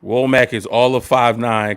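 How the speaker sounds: ordinary talk, nothing marked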